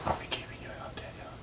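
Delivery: playful hushed whisper; mock whisper. Teasing Danielle about keeping her out, in a mock whisper. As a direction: whisper as if not to disturb her.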